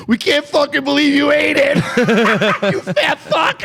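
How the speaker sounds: high-pitched laughing jeer, imitating friends; laughing disbelief. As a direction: crow it through laughter, high and incredulous. Acting out friends' laughing, jeering disbelief.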